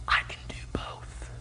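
hushed whisper of Jesus; soft whispered assurance. Acted. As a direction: breathe it quietly as a secret. Jesus voiced softly.

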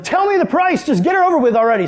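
impatient excitement. Retold or imitated. Impatient demand for the price.

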